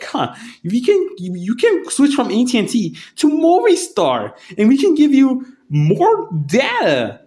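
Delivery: mock the eager phone clerk's pitch; salesman voice. Phone clerk pushing a better plan, high mock sales voice.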